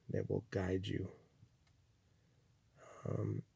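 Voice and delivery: ordinary delivery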